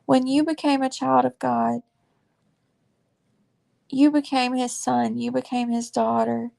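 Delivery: heartfelt preaching. Real, not acted. Speaking straight to viewers who are suffering, fervent preaching.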